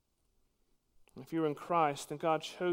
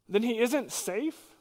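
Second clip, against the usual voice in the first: Lucy asking; 6 semitones higher, slower than usual. Acted. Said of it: "To which Lucy asked".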